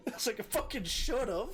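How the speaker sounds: high, laughing through the words; real amused laughter in the voice. Real, not acted.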